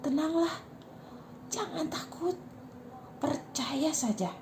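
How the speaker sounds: soft, slowing reassurance; calm a grieving father gently; menenangkan. A narrator's reading. (lembut dan menenangkan)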